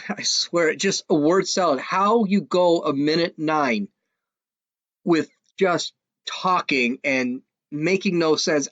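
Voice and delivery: ordinary talk, nothing marked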